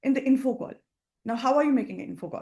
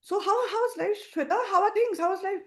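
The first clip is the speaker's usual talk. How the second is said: friend Shashi asking; 8 semitones higher than usual. Shown as a demonstration. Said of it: "Shashi says".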